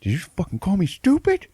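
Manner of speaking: high, loud mock outrage; hammed-up indignant yell; angry robber. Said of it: "are like".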